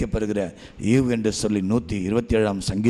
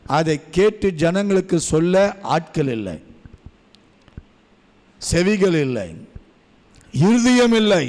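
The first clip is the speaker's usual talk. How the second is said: higher, louder preaching voice; 6 semitones higher, 4 dB louder than usual. Acted. Preacher to the congregation, rising loud preaching. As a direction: build the sermon up with force. Sermon at full force.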